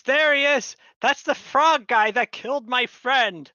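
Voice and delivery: high, loud pointing accusation; guard shouting at the frog guy; accusing shout. Acted. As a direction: yell it out to the whole street. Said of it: "he points at you and goes".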